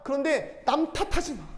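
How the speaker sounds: a lightly voiced, fatherly God scolding and coaxing; God speaking to you. Preacher voicing God telling believers to hold back revenge, fatherly and pleading. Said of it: "주님 말씀하시는 거예요".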